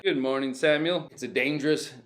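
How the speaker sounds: ordinary talk, nothing marked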